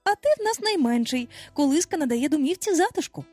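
high, insistent motherly retort; high-pitched, slightly exasperated reasoning; almost cross. A narrator's reading. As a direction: argue back with mild irritation. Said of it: "відказала Венді майже сердито".

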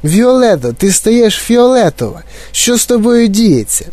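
high-pitched panicked mother; crying out. A narrator's reading. Mother watches her daughter turn violet, crying out. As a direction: exclaim with growing alarm. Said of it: "кричала пані Борегард".

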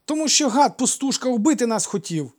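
put-on high, loud little-boy accusation; high-pitched child's outburst. A narrator's reading. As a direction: shout it like an indignant child. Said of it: "заспаний дитячий голос".

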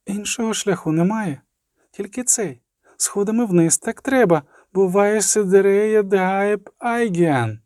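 put-on high girl's voice with a slight tremble; trembling voice. A narrator's reading. Girl explaining the only way down, trembling lightly. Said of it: "сказала тремтливим голосом Цірі".

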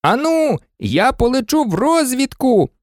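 high-pitched volunteering voice; eager pilot. A narrator's reading. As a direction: pipe up with an offer, very high. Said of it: "запропонував сидячий пілот". (високий голос пілота, що зголошується)

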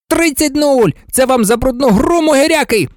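high, triumphant score call; heated partisan sportscaster; gloating commentator. Acted. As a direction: crow at the cheating rivals. Commentator gloats and insults rivals.

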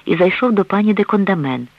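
plain reading voice

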